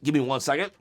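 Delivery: ordinary talk, nothing marked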